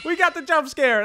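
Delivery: excited. Real, not acted. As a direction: celebrate the win. Finally triggers wanted jump scare.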